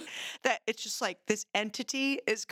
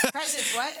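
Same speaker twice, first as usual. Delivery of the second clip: laughing; 3 semitones higher than usual. Real, not acted. Called out, laughing at teasing.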